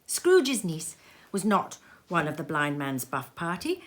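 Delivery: ordinary delivery, nothing marked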